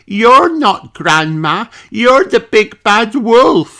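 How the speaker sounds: high, loud, slowing to an accusing cry; Peppa's voice. A narrator's reading. Peppa realises Danny is the wolf, rising alarm. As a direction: build from wonder to alarm.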